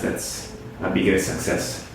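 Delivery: ordinary delivery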